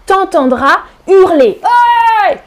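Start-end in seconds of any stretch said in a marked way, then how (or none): 1.6-2.4 high, happy cry of yes; excited cheer. Real, not acted. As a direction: cheer like the answer's right. Cheering students' answer.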